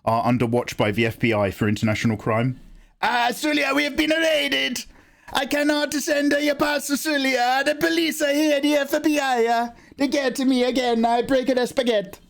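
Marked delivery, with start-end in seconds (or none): 3.0-12.2 high, hammed-up Italian postman voice; Italian accent. Italian postman telling Sulia he has been raided, hammed-up Italian accent. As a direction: panic like a cartoon Italian postman. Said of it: "an Italian postman".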